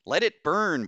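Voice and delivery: high, loud defiant cry. A narrator's reading. Doctor defies order to douse fire, loud cry. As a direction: cry it out over the commotion. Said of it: "Bose cried".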